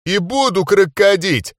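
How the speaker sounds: rowdy battle cry; raging shout. A narrator's reading. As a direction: yell it like a frenzied brawler. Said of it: "продолжали бесноваться мои соратники".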